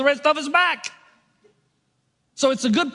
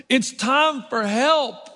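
slow emphatic; much slower than usual. Real, not acted. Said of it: "preacher's slowed punchline".

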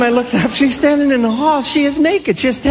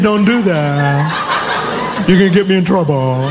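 put-on child making excuses; 6 semitones lower than usual. Child claims she warned the cat, mock earnest.

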